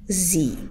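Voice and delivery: "Zi" said with a voiced z and a long i; sie with z sound. Shown as a demonstration. Teacher models "Zi" for students, voiced z and long i. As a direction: say "Zi" like the Romanian word for day, z and long ee. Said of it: "Gândiți-vă ca la ziua de afară".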